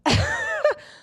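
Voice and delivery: high burst of disbelieving laughter; incredulous laugh. Real, not acted. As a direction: laugh out in shock. Shocked at the cheating news.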